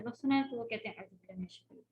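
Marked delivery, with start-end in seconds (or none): 1.0-1.8 barely audible mumbled refuge formula; imitating hushed recitation. Teacher mimicking how students start their recitation, hushed mumble. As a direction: mimic students who murmur the opening under their breath. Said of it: "مسلم لوگ کیا کرتے ہیں، جیسے کہتے ہیں".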